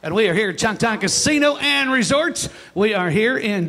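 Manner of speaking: loud, punchy show-opener announcing; radio show host opening. Acted. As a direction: welcome the crowd with big emcee energy. Host opens live show.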